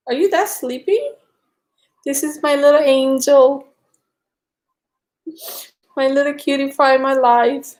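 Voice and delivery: high, tender cooing to a pet; doting pet talk. Real, not acted. Cuddling her dog on camera, high doting coo.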